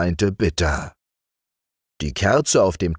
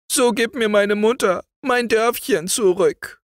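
put-on higher girl's voice, plaintive and lightly tearful; lamenting girl. A narrator's reading. Weeping maid laments to the youth, higher and plaintive. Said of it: "jammerte die Kleine".

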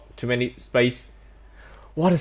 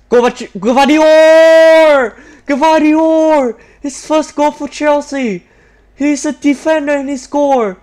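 very high, loud, drawn-out scream of the name; ecstatic goal scream; 12 semitones higher, 9 dB louder, slower than usual. Real, not acted. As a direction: lose it completely as the goal goes in. Defender scores surprise goal.

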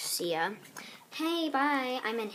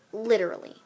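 loud angry literally; 9 dB louder, faster than usual. Acted. Character threatening the new girl.

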